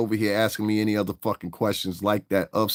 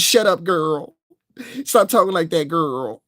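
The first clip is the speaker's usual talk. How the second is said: high, loud mock girly voice; higher loud mocking sing-song; mocking effeminate greeting; 8 semitones higher, 5 dB louder than usual. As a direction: ham it up like a mincing man. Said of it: "even the men sound like bitches".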